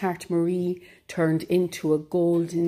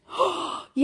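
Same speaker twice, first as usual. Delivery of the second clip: surprised gasp; 12 semitones higher, 3 dB quieter than usual. Acted. Cows react to right answer.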